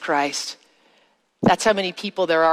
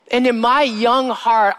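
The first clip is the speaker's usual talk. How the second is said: ordinary talk, nothing marked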